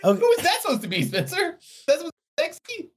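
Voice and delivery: very high, loud squeak; squeaky high voice. Pretend. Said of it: "I sound like the fucking Crypt Keeper".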